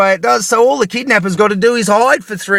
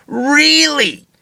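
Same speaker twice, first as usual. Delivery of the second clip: sarcastic disbelief; 5 semitones higher than usual. Real, not acted. Sarcastic retort.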